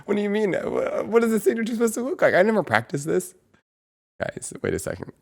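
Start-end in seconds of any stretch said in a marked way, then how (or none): 0.0-3.3 high, loud, fast alarmed questions; flustered panic. Real, not acted. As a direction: realize you've done it wrong all along. Panicking about her signature.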